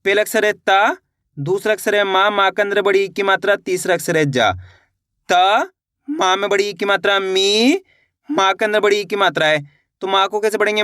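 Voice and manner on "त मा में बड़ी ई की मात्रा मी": slow spelling-out, त and मी held and rising; syllable by syllable. Shown as a demonstration. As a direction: sound out त, then chant out the vowel sign to a long मी. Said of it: "एक अक्षर का उच्चारण किस तरीके से".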